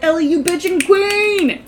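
hyped cheer. Retold or imitated. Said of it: "And I was like".

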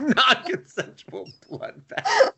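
giggling through the words; laughing repeat. Real, not acted. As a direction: repeat it while cracking up. Laughing repeat of partner's line.

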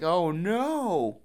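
high, drawn-out wail; mock dismay. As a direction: lament it with hammed-up horror. Mock dismay at fallen rocks.

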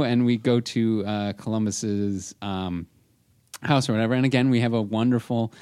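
ordinary talk

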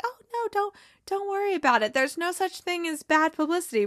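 high, gushing starstruck voice; starstruck Keeley voice. Retold or imitated. Starstruck publicist reassuring a star, high and gushing. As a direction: squeak it out, all flustered.

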